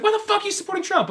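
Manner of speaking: incredulous friends. Retold or imitated. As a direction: squeal it in disbelief.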